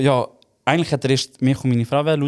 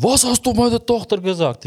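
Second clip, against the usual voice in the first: angry father; 9 semitones higher than usual. Retold or imitated. The father storms up, demanding answers in a raised voice. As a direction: confront him like an outraged dad. Father confronts him angrily.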